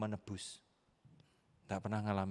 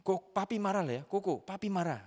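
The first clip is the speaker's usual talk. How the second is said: high, louder parental scolding; mock angry father; 9 semitones higher, 4 dB louder than usual. Father telling off his four-year-old, imitated angry and high. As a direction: scold a small child, acted out. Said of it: "marah sama dia itu begini".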